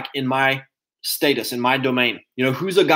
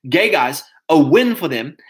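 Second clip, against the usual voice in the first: ordinary talk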